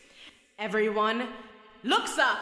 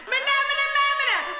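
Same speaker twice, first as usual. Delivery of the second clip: crowd yelling back; 13 semitones higher, 7 dB louder, much faster than usual. Acted. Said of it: "and the crowd yells back".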